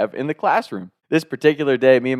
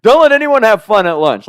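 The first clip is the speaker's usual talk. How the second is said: high, loud mock announcement; mocking yell; 11 semitones higher, 8 dB louder than usual. Boy jeering loudly at the lunch lady, high and mocking. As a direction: jeer at her as the ringleader. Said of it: "I was".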